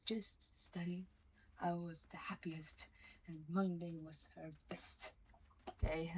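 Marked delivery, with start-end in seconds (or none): 1.6-6.1 soft whispered confession. Real, not acted. Recalling how studying made her happiest, whispered. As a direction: breathe it out as a secret joy.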